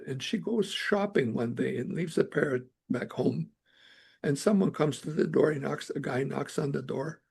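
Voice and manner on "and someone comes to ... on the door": ordinary talk, nothing marked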